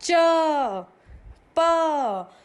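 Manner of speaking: च and प said loud and high with a full अ ending; vowel ending demo; clear अ ending. Shown as a demonstration. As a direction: let the अ ring after च and प. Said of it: "अब देखिए पीछे अ की sound आ रही है ना".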